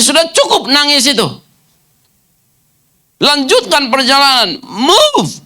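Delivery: high, forceful command to get out; Jesus commanding. Acted. The Lord ordering the hiding disciples out, high and forceful. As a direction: order them out and onward. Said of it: "Maka Tuhan berkata".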